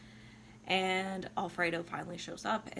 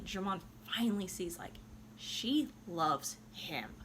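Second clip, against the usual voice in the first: ordinary talk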